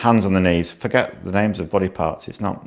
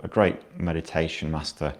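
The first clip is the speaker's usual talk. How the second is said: ordinary talk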